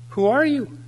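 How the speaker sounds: high-pitched, incredulous question; challenging gatekeeper. Acted. Saint Peter to a newcomer at the pearly gates, high-pitched challenge.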